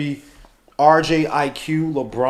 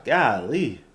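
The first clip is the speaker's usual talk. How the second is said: ordinary delivery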